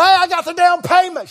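telling the banker he got the money, high and triumphant. Retold or imitated. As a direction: announce the win with glee.

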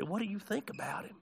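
asked softly; gentle question. Acted. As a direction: put the question softly to a broken woman. Soft question to mocked woman.

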